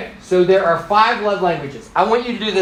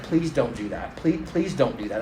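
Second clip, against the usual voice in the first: soft, lowered imitation of a worried plea; horrified plea; 5 semitones lower, 8 dB quieter than usual. Horrified wife begging husband not to do it, quiet mock plea. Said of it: "My wife was horrified".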